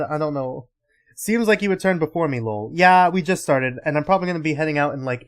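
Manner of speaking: normal talking voice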